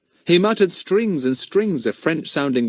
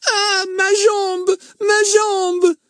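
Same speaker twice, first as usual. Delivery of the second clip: slow, much higher wailing cry in French; 4 dB louder, much slower than usual. A narrator's reading. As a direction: wail it as you limp out. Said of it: "he wailed as he went".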